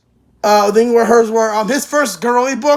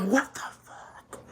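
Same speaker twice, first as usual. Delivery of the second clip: slow, breathless whispered disbelief; stunned whisper; 4 semitones lower, 15 dB quieter, slower than usual. Retold or imitated. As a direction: barely mouth it, aghast. Stunned at demonic faces scene.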